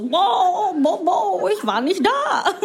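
high, drawn-out boiled-fish impression; boiled-fish energy. Mocking Olaf Scholz as a boiled fish, high moaning impression. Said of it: "so eine Energie von so einem gekochten Fisch".

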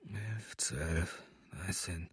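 barely audible counting under the breath; very soft, low mumbled tally; whispering. A narrator's reading. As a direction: count almost silently to yourself. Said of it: "flüsterte er".

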